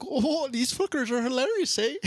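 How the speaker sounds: mock Canadian accent for a drunk fisherman, played for laughs; exaggerated Canadian accent, lifting up into the eh. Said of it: "They're in Canada".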